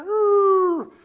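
a high, loud celebratory woo; excited whoop. Real, not acted. Preacher whoops at the congregation, excited. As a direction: whoop to fire up the crowd. Crowd energy after a line.

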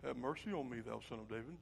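very low, soft murmur; meek, silent-worship plea. Acted. Blind man trying to keep church quiet, meek murmur. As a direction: say it timidly under your breath. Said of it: "We wanna be silent in our worship".

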